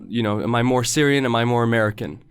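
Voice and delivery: normal talking voice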